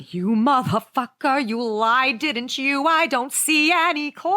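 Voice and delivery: hammed-up betrayed complaint; mock outrage.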